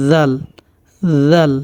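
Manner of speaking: জাল as a voiced "th", "dhal"; tongue tip at upper front teeth for জাল. Shown as a demonstration. Teacher models the Arabic letter, lisped voiced "th" in জাল. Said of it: "জিহ্বার আগা".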